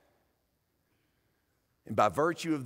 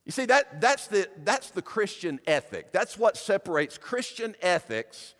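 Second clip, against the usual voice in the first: preaching at full force; 5 semitones higher, 5 dB louder than usual. Acted. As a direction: proclaim it over the congregation. Preaching builds to proclamation.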